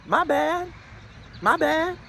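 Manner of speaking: casual adult brush-off in a high voice; imitating the toddler's adult-sounding apology; toddler talking like an adult. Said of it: "He literally said it like that".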